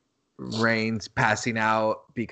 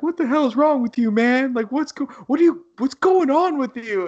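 much higher, loud mock yell; imitating an angry wrestler; 13 semitones higher, 5 dB louder than usual. Retelling Jimmy confronting his brother, high mock yell. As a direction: ham up a shocked, angry plea. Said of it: "he's like".

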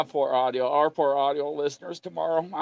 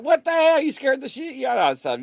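higher, louder startled what-the-hell; startled outburst; 9 semitones higher, 3 dB louder than usual. Retold or imitated. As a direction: jump back in shock. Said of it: "And it was like".

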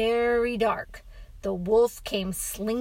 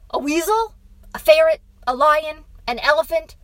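louder, higher desperate offers; bargaining anything but a wolf; pleading; 7 semitones higher, 12 dB louder, faster than usual. Acted. Father pleads for other animal.

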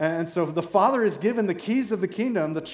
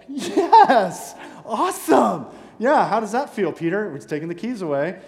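laughing, much higher and louder mock cheer; gloating disciples; 5 semitones higher, 7 dB louder than usual. Disciples mocking Peter losing the keys, laughing high cheer. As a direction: crow with laughing glee over Peter's fall. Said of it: "they're like".

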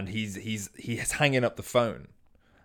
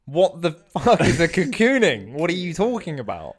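baffled outburst; 7 semitones higher, 7 dB louder than usual. Retold or imitated. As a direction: demand an answer in disbelief. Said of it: "Meghan goes".

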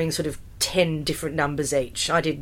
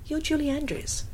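starstruck; 9 semitones higher, 6 dB quieter than usual. Retold or imitated. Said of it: "And I was like".

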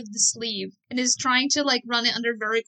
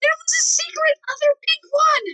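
much higher, loud squealed protest; high-pitched insistent yell; 6 dB louder than usual. Acted. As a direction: yell it back, high and indignant. Acted popsicle quarrel.

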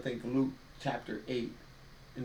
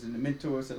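usual speaking voice

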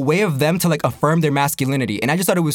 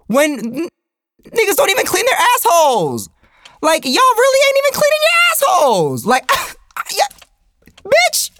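very high-pitched incredulous squeal; outraged disbelief; 13 semitones higher than usual. Real, not acted. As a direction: let the rant soar into falsetto outrage, breaking into laughter. Comic outrage at the punchline.

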